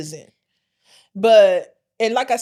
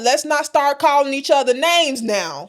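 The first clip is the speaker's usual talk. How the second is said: loud, raised-pitch protest; mock indignant retort; 5 semitones higher, 5 dB louder than usual. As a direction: cut in loudly to halt the name-calling, playfully. Acted retort to name-calling commenter.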